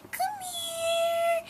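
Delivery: put-on high sing-song baby talk; coaxing a kitten. Coaxing a shy kitten through the fence, high cooing baby talk. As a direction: coo it high to lure the kitten closer. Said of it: "We were like".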